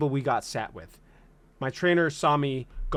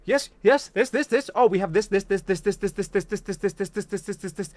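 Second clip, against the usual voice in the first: rapid rattle; 3 semitones higher than usual. Retold or imitated. Imitating trainer rattling items.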